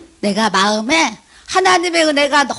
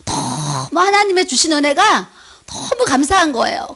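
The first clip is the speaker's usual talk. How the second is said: usual speaking voice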